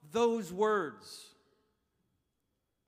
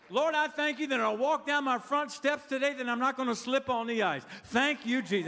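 raised, emphatic preacher's prayer; declarative prayer; 5 semitones higher than usual. Acted. Preacher praying not to slip on ice, bold prayer. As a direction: pray it out boldly, half in jest. Acts out praying for stupid stuff.

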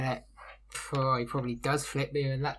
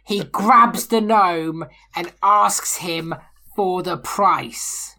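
louder, slow, deliberate over the table noise; raised over crosstalk; 5 semitones higher, 12 dB louder, much slower than usual. Real, not acted. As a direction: say it loud and slow so everyone hears. Player talks over GM.

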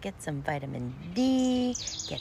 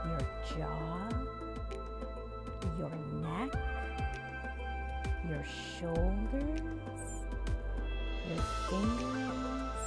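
breathing coach; 3 dB quieter than usual. Acted. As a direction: guide the breaths calmly and softly. Leads guided breathing.

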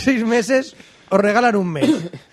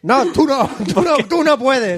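higher, firm no to the customer; mock stern refusal; 6 semitones higher than usual. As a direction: turn him away playfully.

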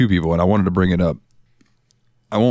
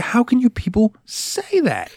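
high, drawn-out disbelieving question; incredulous outcry; 12 semitones higher, slower than usual. Acted. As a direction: ask it aghast, pitch climbing high and stretching the end. Acting out disbelief at covid deniers.

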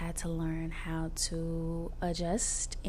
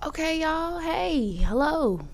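excited greeting; 10 semitones higher, 5 dB louder than usual. Real, not acted. Bright high re-greeting.

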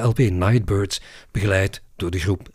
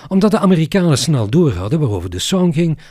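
ordinary delivery

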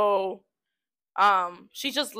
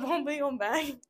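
ordinary talk

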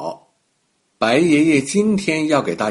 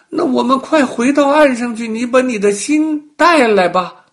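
eager crocodile; 10 semitones higher, 7 dB louder than usual. Acted. Crocodile urges going back.

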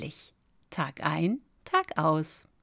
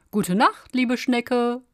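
higher, louder greeting in passing; called good night; 6 semitones higher, 6 dB louder than usual. A narrator's reading. Fox passing the snail at evening, calling out. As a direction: call out good night on the run. Fox calls while running past.

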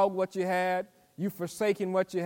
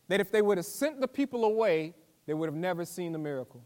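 normal talking voice

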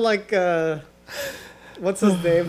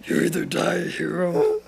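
Yoda voice. Retold or imitated. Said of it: "you're Yoda".

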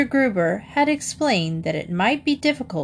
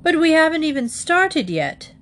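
high-pitched, exasperated objection; astonished father, voiced for a story; incredulous protest; 9 semitones higher, 3 dB louder than usual. A narrator's reading. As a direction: protest in disbelief, voice raised high. Father protests at snack plan.

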